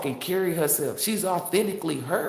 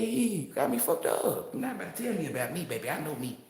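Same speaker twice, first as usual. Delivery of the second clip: ordinary talk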